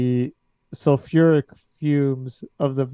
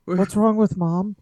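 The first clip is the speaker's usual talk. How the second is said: worried son; 7 semitones higher than usual. Acted. GM voices son.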